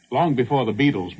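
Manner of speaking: ordinary talk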